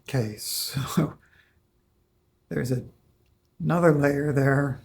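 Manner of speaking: normal talking voice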